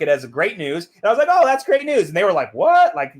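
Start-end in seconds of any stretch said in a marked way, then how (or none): 1.3-2.1 high, loud cheerful reaction; delighted. Retold or imitated. Reacting to cousins' news of the death, delighted. 2.5-2.9 loud, high-pitched astonishment; shocked disbelief. Retold or imitated. Cousins hearing the cheerful reaction, shocked.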